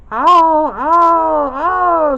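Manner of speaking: loud, high pained screams; witch screaming. Acted. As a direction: scream as the water hits. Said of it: "screamed the witch".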